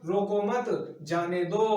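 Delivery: slow रोको मत, gap, drawn-out जाने दो; pause after मत. Shown as a demonstration. Teacher recaps the cleared-up third sentence, pause after मत. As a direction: split it slowly after मत, stretching जाने दो. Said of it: "थर्ड की बात करता हूँ इसने क्लियर किया था".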